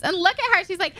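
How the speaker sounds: usual speaking voice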